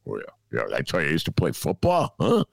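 low, half-hearted macho grunt; trying to sound manly. Retold or imitated. Dad bluffing at car lot to look manly, low mock-macho voice. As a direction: grunt and boast like a pretend tough guy. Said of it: "tried to look manly".

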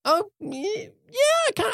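very high, squeaky falsetto hedge; reluctant half-agreement. Real, not acted. Co-host grudgingly conceding the actor was good, squeaky and reluctant. Hesitant reply to co-host's praise.

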